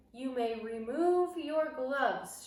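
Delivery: slow, loud, harsh command; drawn-out foreign-witch shout; witch shouting an order. Acted. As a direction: rasp out the order to the whole room. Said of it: "she shouted".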